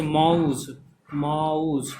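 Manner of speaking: माउस stretched, gliding from आ into उ; diphthong demonstration. Shown as a demonstration. Teacher giving माउस as an example, diphthong drawn out. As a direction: draw out both vowels inside माउस. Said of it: "उ का sound आ रहा है".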